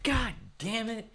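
sudden high, slow exasperated curse; blurted curse. Retold or imitated. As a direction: blurt it out as the rolls hit the floor. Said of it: "you apparently just blurted out".

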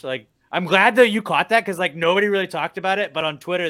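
usual speaking voice

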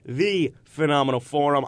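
booming ringmaster intro. Acted. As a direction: announce it like a circus ringmaster. Host's circus-style show opening.

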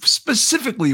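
mock professorial insistence; probing professor. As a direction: pitch it high, pressing for detail. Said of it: "He goes".

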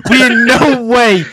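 slow, high and loud; loud emphatic disclaimer. Real, not acted. Host restarts his disclaimer over interrupting friends, loud and emphatic. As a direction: hammer out each word over the laughter.

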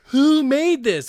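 Who made this said loud, high and slow; mocking exasperation. Mocking people online, Who made this loud and slow. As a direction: drawl Who made this in mock outrage. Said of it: "they're like".

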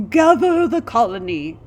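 loud, booming royal decree; heroic regal voice. A narrator's reading. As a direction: proclaim like a warrior king. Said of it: "he said in a heroic, regal voice".

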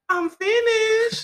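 put-on high, drawn-out child's holler; screaming toddler. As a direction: imitate a little kid yelling from the bathroom. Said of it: "he'll be screaming, I can hear that voice".

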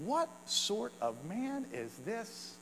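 soft, slow awed asking; hushed wondering question. Pretend. Crowd wondering aloud about Jesus, hushed and slow. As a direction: ask it slowly under your breath in wonder. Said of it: "might ask this question".

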